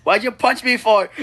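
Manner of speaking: high, loud protest; cartoonish wounded outrage; indignant outburst. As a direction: demand an answer, outraged. Learns he was punched.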